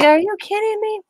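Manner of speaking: high, incredulous cry; mock disbelief. Telling her friend about ASMR fingernail tapping, mock disbelief. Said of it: "I was like".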